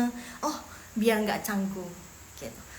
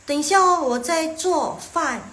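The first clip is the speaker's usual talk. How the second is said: higher, louder acted reply; replying to friend; 5 semitones higher, 7 dB louder, slower than usual. Answering a friend's invitation while cooking, higher and louder. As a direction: call back that you are busy. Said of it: "teman kalian nanya".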